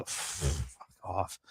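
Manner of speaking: low, slow muttered give-up; frustrated give-up. Real, not acted. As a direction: drop it in disgust. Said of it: "gives up on the name".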